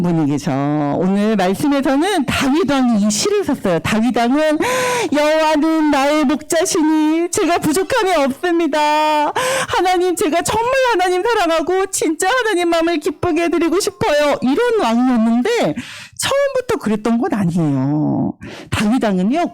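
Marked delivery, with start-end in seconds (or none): 5.1-14.4 higher, earnest voice of devotion; David praying. Acted. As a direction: profess love to God in a raised pitch. Acts King David praying devoutly.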